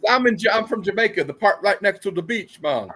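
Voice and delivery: mocking a movie's terrible Jamaican accent. Mocking a movie character, hammed-up bad Jamaican accent. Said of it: "the worst Jamaican accent you've ever heard".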